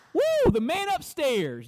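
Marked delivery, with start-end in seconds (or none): 0.1-0.5 sudden high, loud whoop of awe; excited whoop. Real, not acted. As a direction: cry out in amazed delight. Preacher exclaiming at God's holiness.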